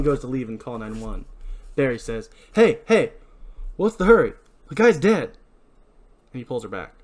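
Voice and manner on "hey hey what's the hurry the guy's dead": urgent halting. Acted. Barry stops Helen leaving.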